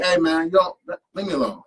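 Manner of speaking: mock-annoyed brush-off; annoyed kid. Kid pushing back.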